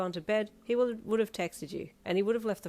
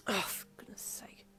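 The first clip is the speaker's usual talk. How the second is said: slow, whispered mutter; 7 dB quieter than usual. A narrator's reading. Exasperated girlfriend muttering to herself, whispered. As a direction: mutter it under the breath. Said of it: "he muttered".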